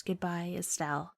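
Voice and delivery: very soft, low goodbye; final farewell. Acted. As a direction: let the parting words die away. Joshua's last goodbye as screen darkens.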